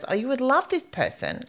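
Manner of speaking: high, enthusiastic friend's voice; gushing. Retold or imitated. Friend's gushing recommendation, retold.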